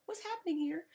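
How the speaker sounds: soft, high, puzzled asking; confused whisper-quiet. Retold or imitated. Vlogger retelling her own confusion, soft and high. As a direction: murmur in bewilderment. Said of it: "I was like".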